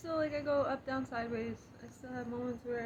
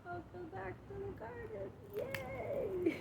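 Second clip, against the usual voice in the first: high, bright cheer, then a squealed yay; pitched way up with delight; excited welcome; 9 semitones higher, slower than usual. Real, not acted. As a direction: greet them like a joyful hostess. Welcoming back to the garden.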